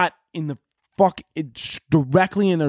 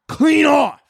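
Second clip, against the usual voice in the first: shouted punchline, higher; sudden shout; 9 semitones higher, 5 dB louder than usual. Acted. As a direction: blurt it out like a gunshot. Turkey shot in the story.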